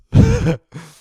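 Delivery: loud burst of laughter; real loud laugh at his own awkwardness. Real, not acted.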